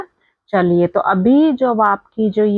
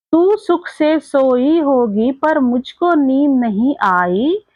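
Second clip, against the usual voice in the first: slow, higher-pitched singsong recital; poem recitation; 4 semitones higher, slower than usual. Acted. Teacher reciting a poem to young pupils, slow lilting recitation. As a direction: recite it slowly in drawn-out, lilting lines. Re-reads poem line before explaining.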